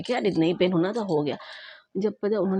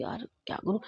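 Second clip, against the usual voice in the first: hushed despair; 3 semitones higher, 8 dB quieter than usual. Real, not acted. Despairing after the test was postponed.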